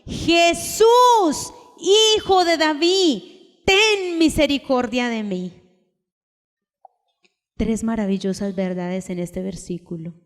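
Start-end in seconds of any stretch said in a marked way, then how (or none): 0.0-5.5 a blind man calling to a passing crowd for help; crying out. Retold or imitated. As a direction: cry out to Jesus for mercy from afar. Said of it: "él dio voces diciendo".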